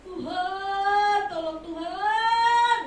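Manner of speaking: loud, high wailing cry; reenacted sobbing cry to God; crying plea. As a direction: wail it out slowly like a desperate prayer. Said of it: "Saya nangis sampai jerit-jerit".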